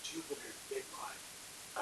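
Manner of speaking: slow, secretive whisper to a god; whispered prayer. Retold or imitated. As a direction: whisper it like a private plea, slowly. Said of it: "He's like".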